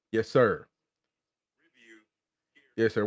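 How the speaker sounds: ordinary talk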